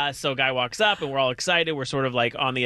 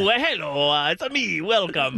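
higher-pitched, muddled fake Italian; botched Italian accent; 3 semitones higher, slower than usual. Joking waiter welcoming diners, muddled fake Italian accent. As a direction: welcome them in a badly faked Italian accent. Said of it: "That's Italian?".

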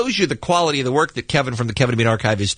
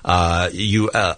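normal talking voice

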